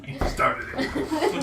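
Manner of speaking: high, slow through laughter; cracking up mid-line; laughing. Real, not acted. Group cracking up.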